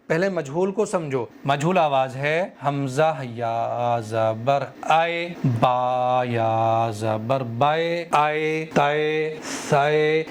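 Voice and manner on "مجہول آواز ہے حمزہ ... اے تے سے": "بے" and "اے" said with a long open e sound; majhul vowel. Shown as a demonstration. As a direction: demonstrate the wrong e-vowel in "بے". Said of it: "یہ سب کیا ہے؟ مجہول آواز ہے". ("اے، بے، تے، سے" مجہول آواز میں)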